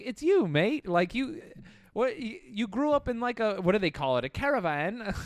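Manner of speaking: a hint of Australian, teasing; Australian accent. Australian guy telling comedian he's a bogan, light accent. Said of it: "he was like".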